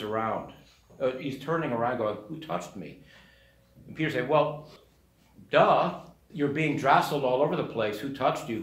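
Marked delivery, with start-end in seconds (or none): 2.3-3.0 low, soft, wondering ask; Jesus quietly asks who touched him; puzzled quiet question. Retold or imitated. A hushed, searching question. 4.3-8.0 louder, scoffing, with a duh tone; exasperated retort. Retold or imitated. Peter scoffs back at Jesus, louder. 8.0-8.6 mock disbelief on touched, a light sneer; scoffing echo. Peter mocking Jesus' question, scoffing.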